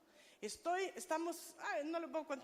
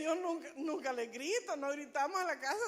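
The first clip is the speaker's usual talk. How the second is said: usual speaking voice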